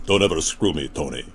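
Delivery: low, menacing drug lord; Sosa gangster voice. Pretend.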